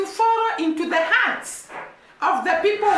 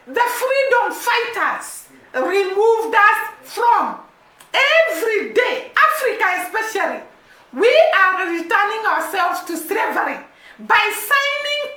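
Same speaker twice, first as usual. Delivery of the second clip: impassioned preaching; 3 semitones higher than usual. Real, not acted. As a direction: hammer each contracts line like a sermon. Impassioned speech against foreign debt.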